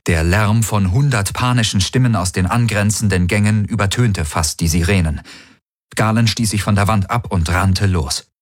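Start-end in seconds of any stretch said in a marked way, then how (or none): none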